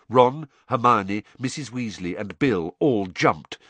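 plain reading voice

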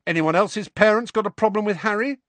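high, loud aggressive challenge; aggressively. A narrator's reading. Ron challenging Dean and Neville, high and aggressive. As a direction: challenge the room. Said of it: "he said aggressively".

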